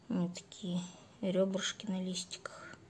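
hushed fond whisper. Real, not acted. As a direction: whisper it lovingly. Admiring plant, hushed aside. (шёпотом)

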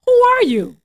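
startled question. A narrator's reading. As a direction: read it as a father taken aback. Isaac startled by second son.

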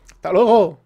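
loud mocking farewell yell; sudden loud see-you-later; shouted goodbye. Real, not acted. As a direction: shout it after what you toss away. Throwing something away, calling out.

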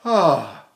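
low sighed "ah"; demonstrated sigh. Shown as a demonstration. Teacher quoting advice to sigh all the time, sighing. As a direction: let out a sigh on "ah". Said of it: "Sigh all the time".

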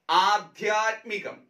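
ആധ്യാത്മികം said slowly with a long opening ആ; correct form demo. Shown as a demonstration. Teacher to exam students, slow correct ആധ്യാത്മികം. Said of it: "അധ്യാത്മികം എന്ന option".

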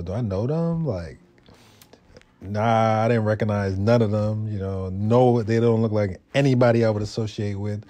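ordinary delivery

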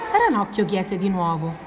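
usual speaking voice

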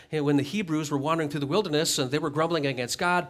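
normal talking voice

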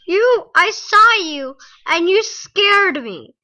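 slow, loud, high after a fright; scream into giggles. Real, not acted. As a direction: still shaken from the scare. Just scared by the dog.

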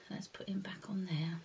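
soft whispered aside; whispering. Real, not acted. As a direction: whisper it under your breath. Aside to class.

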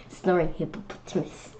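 ordinary delivery, nothing marked

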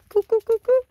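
high, loud cooing call; chicken call. Real, not acted. Calling her chickens to come running, high loud coo. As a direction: sing it out high to call the hens.

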